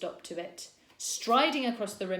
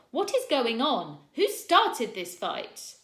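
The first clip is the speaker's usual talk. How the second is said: higher, louder, slowing down; angry demand; 5 semitones higher, 8 dB louder, slower than usual. A narrator's reading. Teacher confronting fighting boys, angry demand.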